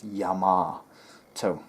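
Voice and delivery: Yamato as 'yah-MAAH-toe', slow and stretched; English-American pronunciation. Shown as a demonstration. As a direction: say Yamato slowly with a long open 'ah'. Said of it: "That's how it's pronounced".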